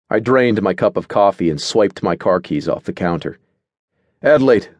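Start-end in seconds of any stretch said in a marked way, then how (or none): 4.2-4.7 louder, higher, curt name call; short. A narrator's reading. Narrator calls Adelaide away from the quarrel, shortly. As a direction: cut in with just the name. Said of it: "said shortly".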